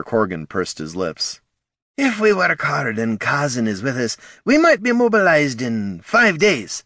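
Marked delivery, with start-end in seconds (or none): 2.0-4.2 a hint of a gruff, higher dwarf; lightly voiced dwarf guildmaster. A narrator's reading. Corgan's voice in narration. 4.4-6.8 higher, matter-of-fact dwarf; dwarf guildmaster voice. A narrator's reading. Corgan states estimate.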